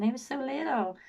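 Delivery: high-pitched mock little-girl imitation; mocking high American-style simper; pathetic, childlike voice. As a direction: mimic her as pathetically childlike, pitched right up. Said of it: "her voice was so pathetic".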